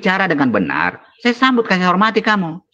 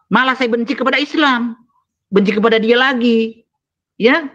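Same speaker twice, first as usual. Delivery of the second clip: heated, raised pitch, some force; angry; 5 semitones higher than usual. Real, not acted. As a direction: let the anger climb in pitch. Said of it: "Marah saya".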